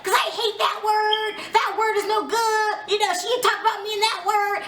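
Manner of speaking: high-pitched whiny mimicry of someone protesting; mock defensive outburst. Recapper mocking castmate defending herself, high insistent voice. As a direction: imitate her digging in, high and insistent. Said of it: "get loud and be really, really firm".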